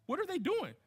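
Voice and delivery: excited question. Real, not acted. Rhetorical question to congregation.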